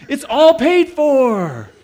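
very high, stretched-out shout; gleeful announcement. Retold or imitated. Manager answering a puzzled customer, long high holler. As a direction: holler it long and loud like a proclamation.

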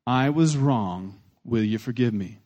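slow, measured delivery of the magic words; slow, deliberate. Shown as a demonstration. Pastor models an apology for his congregation, slow and deliberate. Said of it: "Here's the seven magic words".

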